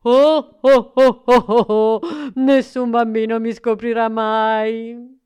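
booming jolly laugh. Acted. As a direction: laugh big and round. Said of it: "Babbo Natale rise di nuovo". (risata fragorosa)

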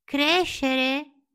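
high, loud wondering puppet; curious. Acted. Pinocchio puzzled by the fox, high and curious. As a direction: ask it full of wonder. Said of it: "chiese il burattino incuriosito".

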